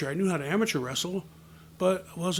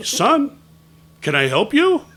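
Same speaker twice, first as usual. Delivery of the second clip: much louder, higher, pointed question; insistent questioning; 7 semitones higher, 12 dB louder than usual. Retold or imitated. Man gets no answer from boy, asking loudly and insistently. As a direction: demand an answer from the kid.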